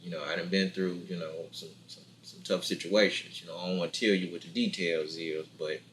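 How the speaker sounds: deep, soft, hushed reassurance; low reassuring promise. Retold or imitated. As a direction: quietly assure her you've got her.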